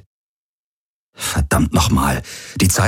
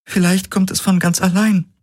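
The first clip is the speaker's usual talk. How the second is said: hohe Frauenstimme, traurig und resigniert; 13 semitones higher than usual. Acted. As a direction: sag es hoch und schwer, voller Abschied. Ki voiced. (Ki zu Galen: hohe, traurige Stimme)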